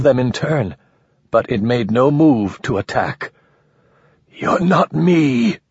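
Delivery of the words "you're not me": gasped. Acted. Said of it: "the man gasped".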